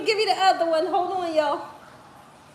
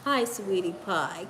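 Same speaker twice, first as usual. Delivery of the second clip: lower, soft hello to the puppy; tender greeting; 10 semitones lower, 8 dB quieter than usual. Real, not acted. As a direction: greet the pup gently.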